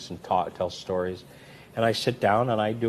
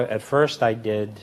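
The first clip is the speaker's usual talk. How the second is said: ordinary talk, nothing marked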